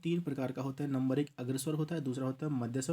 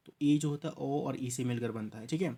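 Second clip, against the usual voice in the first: ordinary talk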